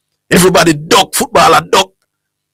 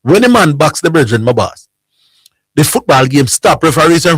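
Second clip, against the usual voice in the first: ordinary delivery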